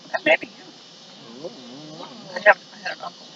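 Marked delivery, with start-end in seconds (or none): none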